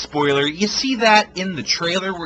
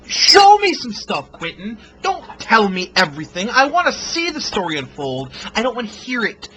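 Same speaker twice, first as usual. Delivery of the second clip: raised, exasperated demand to the director; frustrated plea; 6 semitones higher, 5 dB louder than usual. Real, not acted. Reviewer pleading with Quentin directly, exasperated and raised. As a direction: beg him to just show it.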